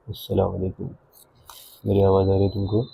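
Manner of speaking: teacher murmuring a greeting down a string phone; soft into string phone. Shown as a demonstration. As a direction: speak softly so only the listener hears. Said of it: "आराम से बोलो".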